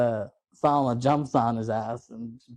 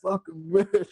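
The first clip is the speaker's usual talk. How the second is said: high-pitched, hammed-up last words; mock dying curse from the floor; imitated dying man; 8 semitones higher, slower than usual. Quoting the dying man's line.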